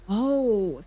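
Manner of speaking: ordinary delivery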